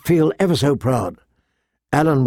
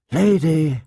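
ordinary delivery

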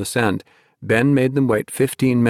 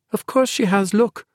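put-on high, eager young girl; high-pitched girl, sure of herself; girl's voice; 11 semitones higher than usual. A narrator's reading. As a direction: point it out brightly as the girl. Naomi's voice, girl character.

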